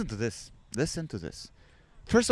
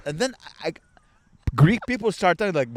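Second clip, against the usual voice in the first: usual speaking voice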